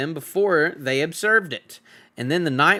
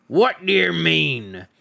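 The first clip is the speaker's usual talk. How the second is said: demanding troll; 4 semitones higher, slower than usual. Acted. Troll Bert holds the hobbit by the hair, demanding. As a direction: drag out the demand menacingly.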